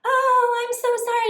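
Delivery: high-pitched, gushy apology imitation; mock high-voiced apology; apologetic woman. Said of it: "she was like".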